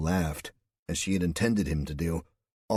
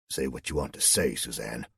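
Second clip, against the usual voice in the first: lower, clipped-off testy reply; a hint of irritation; testy colonel; 6 semitones lower than usual. A narrator's reading. Colonel snapping at Suzanne, lower and testy. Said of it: "replied almost testily".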